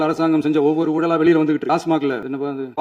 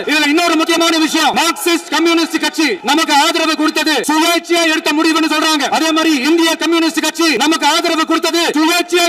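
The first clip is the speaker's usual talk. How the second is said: raised voice; 10 semitones higher than usual. Real, not acted. Leader defying accusers in the assembly, raised voice so they hear. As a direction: raise your voice far above usual, insistent and sustained. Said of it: "நான் ஏன் கொஞ்சம் சவுண்டா பேசுறேன்".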